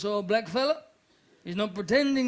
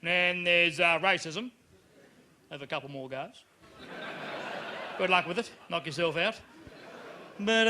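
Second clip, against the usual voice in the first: lightly voiced broad Australian official; casual Australian telling-off; 3 semitones lower than usual. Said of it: "It's so very Australian too".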